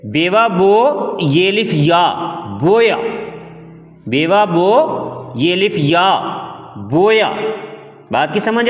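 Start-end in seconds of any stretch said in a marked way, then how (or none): 0.1-3.0 slow sounding out. Shown as a demonstration. Teacher sounds out बेवा बो ये लिफ या slowly for pupils. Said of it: "अच्छा, इसमें है". 4.1-7.3 slow sounding out. Shown as a demonstration. Teacher sounds out बेवा बो ये लिफ या slowly for pupils. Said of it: "अच्छा, इसमें है".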